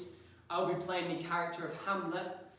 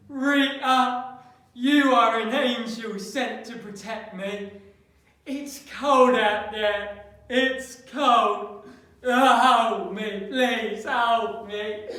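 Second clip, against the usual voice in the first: loud, drawn-out imitation of a man sobbing; mock heaving sobs; 6 semitones higher, 13 dB louder, much slower than usual. [name] imitating Wally crying to Rita, mock sobbing plea. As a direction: wail it out as a hammed-up impression of Wally's sobs. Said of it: "Big heaving sobs, he says".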